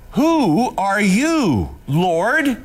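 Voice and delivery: slow, awed cry from the ground; Saul crying out. Acted. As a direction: ask it slowly in awe. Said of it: "cries out".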